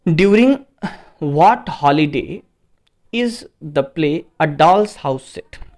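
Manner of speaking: slow, spaced-out reading of the question; teacher reading the exam question slowly to the class; slow dictation. Acted. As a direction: read each word out as if for dictation. Teacher reads quiz question slowly.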